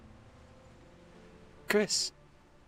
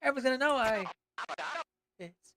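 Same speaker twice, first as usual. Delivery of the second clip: higher, wounded lament; dramatic character voice; 9 semitones higher than usual. Acted. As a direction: play it as a hurt, lonely creature.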